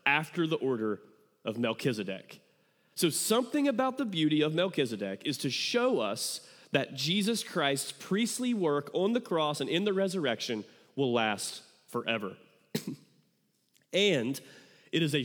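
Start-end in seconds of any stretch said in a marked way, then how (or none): none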